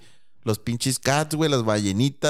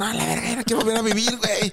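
put-on pitched up, a jaded toddler fed up with life; a kid sighing that he's done with living, in a higher mock voice; mock weary kid; 7 semitones higher than usual. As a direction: play a tired little kid, higher. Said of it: "todavía se acuerdan de su vida pasada y dicen".

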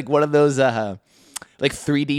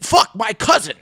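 loud, raised, exasperated dad; mock exasperation; 9 semitones higher, 5 dB louder than usual. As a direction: snap it as if it were obvious. Dad snaps at a dumb question.

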